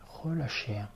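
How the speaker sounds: ordinary delivery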